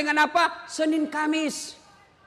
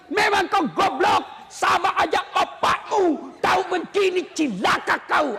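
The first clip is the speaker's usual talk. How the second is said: cursing wife; 7 semitones higher than usual. Acted. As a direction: shout the abuse at the husband. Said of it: "yang tidak boleh maki-maki suami". (istri memaki suami dengan marah dan keras)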